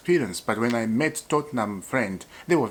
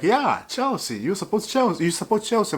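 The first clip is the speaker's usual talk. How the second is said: higher, eager mock-surprised questions; excited retelling; 9 semitones higher than usual. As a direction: replay your own eager reaction. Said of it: "I was like".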